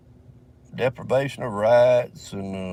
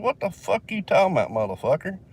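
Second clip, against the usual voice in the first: retold cussing-out, higher and sharp; angry retort; 7 semitones higher than usual. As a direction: throw the cop's words back at him, heated. Cousin cursing out the cop.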